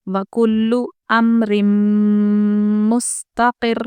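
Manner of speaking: slow measured recitation of वकुल्लु अम्रिम मुस्तक़िर; tajweed recitation; Quran recitation. Shown as a demonstration. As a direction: recite slowly with doubled ल in वकुल्लु. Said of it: "हम जल्दी पढ़ेंगे".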